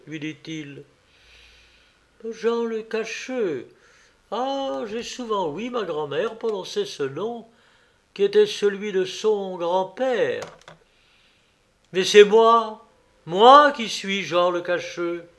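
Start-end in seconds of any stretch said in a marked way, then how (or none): 2.3-10.4 put-on young peasant woman, wondering. A narrator's reading. Young peasant woman answering the old man, wondering. 11.9-15.3 put-on loud, higher old man declaring himself; emphatic revelation. A narrator's reading. Old man telling the young woman who he is, emphatic.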